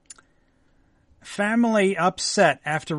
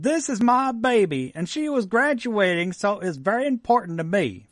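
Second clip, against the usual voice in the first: put-on Texan accent; 5 semitones higher than usual. Newscaster quoting the mom in a Texan accent. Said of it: "with her Texan accent, which is not very good".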